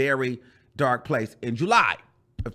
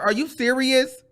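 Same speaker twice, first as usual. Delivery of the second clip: disbelief; 9 semitones higher than usual. Retold or imitated. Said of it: "So I'm like".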